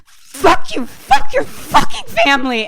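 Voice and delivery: loud, high-pitched enraged yelling; furious shout. Retold or imitated. Grace screaming at Mom while hitting her, furious shout. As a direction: hurl the curse with each blow.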